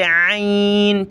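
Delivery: "आइन" squeezed from the throat and drawn out slowly; throaty ayn. Shown as a demonstration. Teacher isolating the letter, "आइन" slow and pharyngeal. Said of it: "आइन को गले से निकाले".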